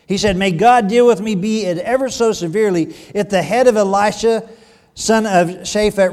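loud, projected Bible reading; emphatic pulpit reading of scripture. A narrator's reading. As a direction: read the verse out to the whole congregation.

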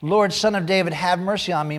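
crying out. A narrator's reading. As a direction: cry out for mercy, as read aloud. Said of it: "crying out".

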